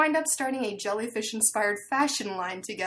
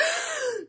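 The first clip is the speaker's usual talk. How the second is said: sharp high gasp of sudden realization. Retold or imitated. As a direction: suck in air as it dawns on you. Realizing the problem, story.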